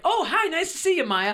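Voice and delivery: high, gushing fake hello; mock friendly greeting. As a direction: imitate an overfriendly industry greeting. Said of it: "are all like".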